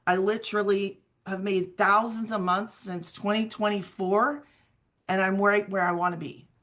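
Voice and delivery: usual speaking voice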